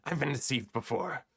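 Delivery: a touch of hurt suspicion, acted; wary character's line. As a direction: play a mildly mistrustful character. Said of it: "shakes his head and he's like".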